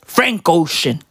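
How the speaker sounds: ordinary delivery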